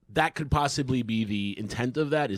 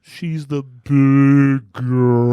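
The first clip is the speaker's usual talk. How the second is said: mock voice; 4 semitones higher, 12 dB louder, much slower than usual. Mock deep slow voice teasing.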